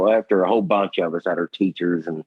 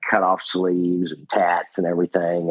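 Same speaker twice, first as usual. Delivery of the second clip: ordinary talk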